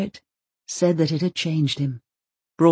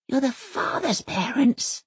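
disbelieving; 7 semitones higher than usual. Acted. Elsa repeats in disbelief.

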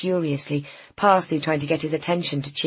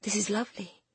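hushed wonder; 5 semitones higher, 10 dB quieter than usual. A narrator's reading. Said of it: "she ran her hands along the shelves".

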